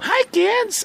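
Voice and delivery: put-on high, bright greeting to little kids; kids' show host. Parent mimics a kids' cartoon host, high and cheery. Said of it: "Katong Mickey Mouse Clubhouse".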